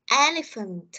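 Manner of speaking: Elephant with EL punched, falling after; word-stress model. Shown as a demonstration. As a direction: model Elephant, first syllable strongest. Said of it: "gọi là cái dấu đánh trọng âm". (Elephant nhấn âm đầu, hạ giọng cuối)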